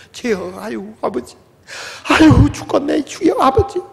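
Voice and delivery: groaning prayer. Pretend. Mock praying under affliction.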